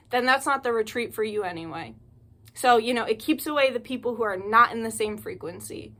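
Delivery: ordinary talk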